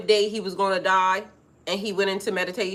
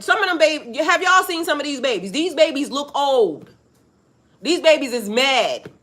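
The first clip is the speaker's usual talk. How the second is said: higher and louder, worked up; excited; 6 semitones higher, 4 dB louder than usual. Real, not acted. Telling the audience about angry babies, higher and louder.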